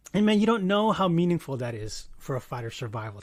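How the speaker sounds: ordinary talk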